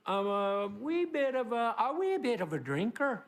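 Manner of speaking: a quick slip into a light Irish lilt; a hint of an Irish brogue; Irish accent. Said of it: "I don't know why I went Irish there".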